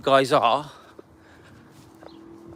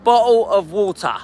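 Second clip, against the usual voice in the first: British accent; 2 semitones higher, 4 dB louder than usual. Shown as a demonstration. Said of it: "British accent".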